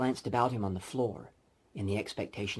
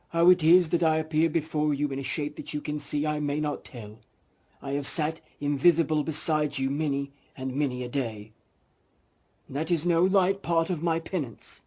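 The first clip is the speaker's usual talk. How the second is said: lightly higher, louder solemn ghost; 8 semitones higher, 8 dB louder than usual. Acted. Marley's ghost warning Scrooge, solemn and a bit higher. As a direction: warn him gravely.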